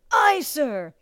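high, strained shout back; recruit's reply. A narrator's reading. Recruit to bellowing drill master, strained shout.

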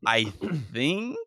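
usual speaking voice

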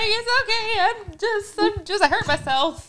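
high, covering up tears; high fake-fine voice. Mom hides her crying from her son, high and forced. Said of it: "I'm like".

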